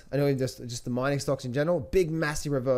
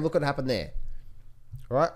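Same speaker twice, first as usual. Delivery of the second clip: ordinary talk, nothing marked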